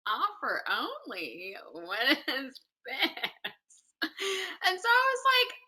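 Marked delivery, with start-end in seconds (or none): 0.1-3.3 slow, softer puzzled questioning; puzzled disbelief. Retold or imitated. Replaying her reading an offer email, puzzled disbelief. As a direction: stretch it out in mock bafflement.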